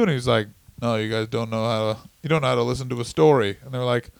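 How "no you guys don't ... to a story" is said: lightly voiced wrestler, low and distant; a hint of a brooding storyteller, pitched down; Rowdy Roddy Piper. Wrestler putting off questioners, lightly impersonated in a low voice. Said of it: "stares into the middle distance".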